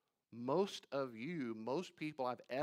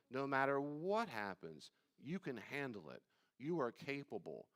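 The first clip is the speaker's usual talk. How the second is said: usual speaking voice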